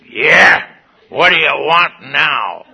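loud, irritable retold growl-back; crusty sponsor. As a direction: play the crusty sponsor, loudly put out. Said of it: "he's crusty".

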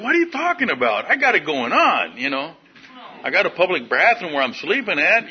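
high-pitched indignant protest; mock insulted. Defendant answering the judge's advice, in insulted protest. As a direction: protest as if truly offended, played for laughs. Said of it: "I was really insulted".

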